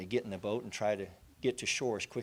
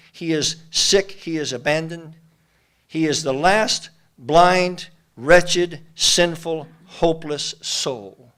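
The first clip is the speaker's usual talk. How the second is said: rising, louder preaching litany, higher pitched; preaching at full force; 4 semitones higher, 10 dB louder than usual. Acted. Preacher builds to a climax for the congregation, loud. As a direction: build the list to a peak.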